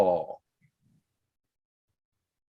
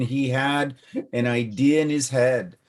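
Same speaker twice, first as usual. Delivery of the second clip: ordinary delivery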